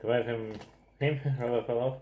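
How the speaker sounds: usual speaking voice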